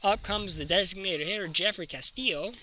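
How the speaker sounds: normal talking voice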